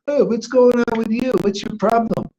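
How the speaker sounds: a scoffing imitation of long-time residents; mocking Vrindavan residents. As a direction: sneer it like a jaded pilgrim. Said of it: "and they'll say".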